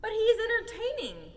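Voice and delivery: high, loud protest; men cry out in the snake's defence; loud high-pitched objection. A narrator's reading. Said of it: "cried the menfolk".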